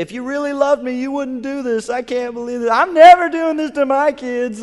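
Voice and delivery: high, loud mock child's protest; hammed-up wail of a manipulative kid. Child to parent about to spank, hammed-up wailing.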